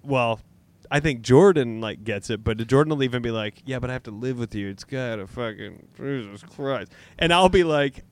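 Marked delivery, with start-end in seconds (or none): 3.7-6.9 quick, muted grumble of a partner; mock long-suffering muttering; imitated weary complaint. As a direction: grumble it under your breath, fast then fading. Acts out his wife's weary complaint.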